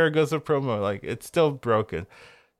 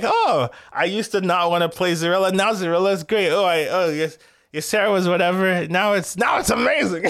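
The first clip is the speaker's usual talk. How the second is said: higher-pitched, excited player's reaction; 7 semitones higher than usual. Acted. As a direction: act out a player's growing delight. Enthusiastic player praising a buff.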